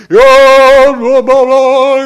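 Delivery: high loud mock babble; mocking blah blah. Preacher mocking the priest's talk to the girl, shrill and loud.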